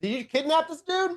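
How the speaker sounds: very high, strained call; shouted question. Acted. Connor checking with his friend across the room, high shouted question. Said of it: "and he shouts".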